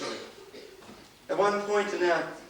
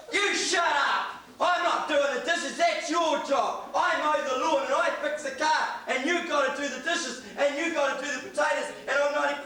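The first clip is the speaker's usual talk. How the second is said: angry husband rant; 8 semitones higher than usual. Retold or imitated. Husband shouting at his wife about the dishes, a hammed-up angry rant. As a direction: bark it out like a domineering husband laying down the law. Husband yelling during marital row.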